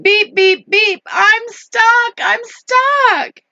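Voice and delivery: high, stretched little-truck wail; hammed-up storybook panic; crying for help. A narrator's reading. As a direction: beep and plead in a high voice. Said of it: "cried the Little Blue Truck".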